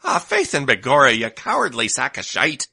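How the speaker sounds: Irish leprechaun voice; stage-Irish scolding. Acted. Leprechaun-like food speaks.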